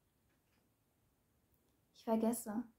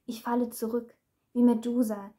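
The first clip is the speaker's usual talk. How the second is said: normal talking voice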